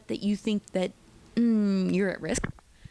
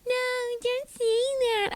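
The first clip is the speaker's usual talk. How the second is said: mock girls. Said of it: "all the girls are like".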